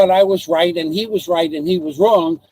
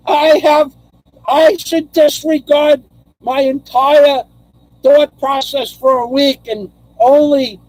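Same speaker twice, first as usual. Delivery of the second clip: higher and louder, hammed-up gushing thanks; mock gratitude; 4 semitones higher, 4 dB louder, slower than usual. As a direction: overplay being overwhelmed by the gift. Overblown gushing thanks to subscriber.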